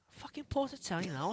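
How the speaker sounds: usual speaking voice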